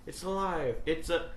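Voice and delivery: a milder mad scientist cry; fading mad doctor; weaker Frankenstein. Retold or imitated. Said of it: "But it goes".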